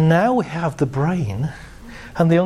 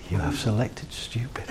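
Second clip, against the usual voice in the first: whispered, lower confirmation; whispered machine reply; 5 semitones lower, 8 dB quieter than usual. Acted. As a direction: breathe it as a hushed computer voice. Unconscious mind repeating.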